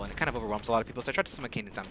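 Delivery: usual speaking voice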